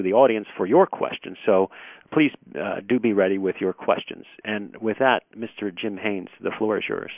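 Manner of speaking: normal talking voice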